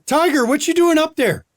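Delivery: high-pitched playful call upward. Acted. Johnny to the rider on the dragon, calling up. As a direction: call up to someone riding overhead.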